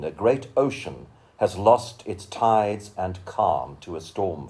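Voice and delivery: measured, lyrical recital of a poem; poetry reading. Acted. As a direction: give each image room, read it like a poet on stage. Reads a poem aloud.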